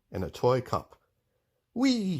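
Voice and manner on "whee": high delighted whee; gleeful whee. Acted. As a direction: squeal with childish delight. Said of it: "he said".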